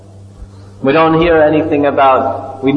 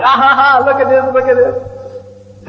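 high laughing voice, delighted at the sight; laughing Balaram; 10 semitones higher than usual. Retold or imitated. Balaram laughing at baby Krishna in the mud, high delighted laugh. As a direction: laugh it out, pointing at the fun. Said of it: "Balaram is laughing".

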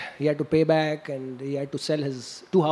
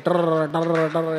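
rolled trrr of a toy rotor; trilled toy helicopter whirr; helicopter noise; much slower than usual. Retold or imitated. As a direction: imitate the buzzing toy with a rolled r. Toy helicopter shown to child.

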